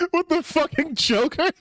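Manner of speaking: squeaky high laugh around the words. Real, not acted. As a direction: laugh out the question, pitch up high.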